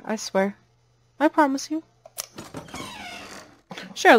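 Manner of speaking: ordinary talk, nothing marked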